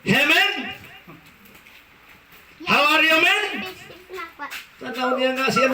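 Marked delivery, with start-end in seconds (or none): 0.0-3.5 high, put-on American voice; mock American greeting. American man greeting the Filipino, high put-on accent. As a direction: greet him like an old buddy.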